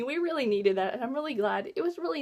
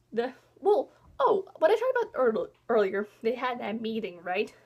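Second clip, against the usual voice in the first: normal talking voice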